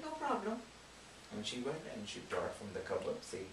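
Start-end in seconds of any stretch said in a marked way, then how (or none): 0.0-0.6 light daughter. Acted. Daughter answers father.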